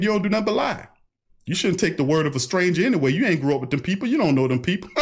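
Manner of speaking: ordinary delivery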